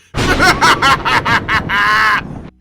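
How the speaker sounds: loud hammed-up villain cackle; evil laugh. Host cackles after mad science line, loud villain laugh.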